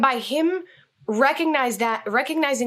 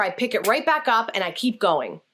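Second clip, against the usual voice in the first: normal talking voice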